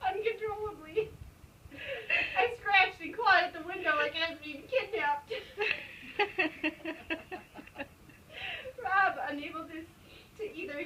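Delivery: laughing. Real, not acted. Reader cracking up mid-story.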